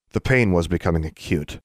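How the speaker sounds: ordinary delivery, nothing marked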